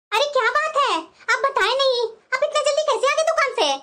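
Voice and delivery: ordinary delivery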